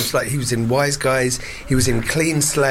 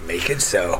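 low husky order; mock seductive command; 7 semitones lower than usual. Captain purring orders at Data. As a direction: purr the command.

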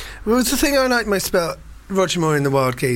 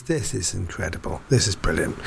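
[name] voice; 6 semitones lower, 6 dB quieter than usual. Retold or imitated. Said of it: "[name] goes".

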